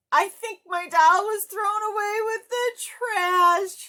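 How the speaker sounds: very high, loud, tearful wail; boy crying to Oscar. Acted. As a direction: sob it out in despair. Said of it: "he cried".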